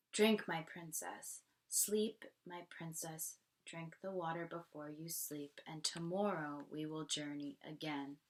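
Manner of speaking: soft, hushed mother; tender lullaby tone. Acted. Mother soothing daughter at bedtime, soft. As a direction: lull her to sleep.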